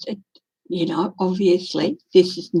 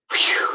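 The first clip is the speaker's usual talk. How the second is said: unvoiced relieved exhale; whispered whew. Real, not acted. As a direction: blow out a shaky whew. Relief after pet fire scare.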